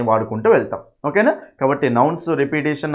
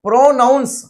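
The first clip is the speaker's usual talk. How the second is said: Pronounce drawn out and pitched high, "pro" set apart from "nouns"; emphatic word breakdown; 7 semitones higher, 3 dB louder, slower than usual. Shown as a demonstration. Teacher showing students the noun inside pronounce, high and emphatic.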